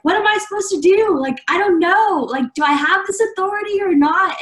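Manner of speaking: high panicked pleading; panicked self. Retold or imitated. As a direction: rush in, frantic and unsure. Retelling her panic at office.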